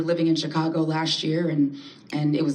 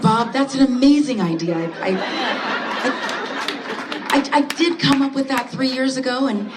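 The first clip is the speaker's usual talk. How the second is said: a light, higher office-polite voice, laughing through it; work voice; 7 semitones higher, 3 dB louder than usual. Retold or imitated. Placating Bob the boss at work, mildly sweet and higher. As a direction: sweetly play along with the boss, a little higher. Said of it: "We say".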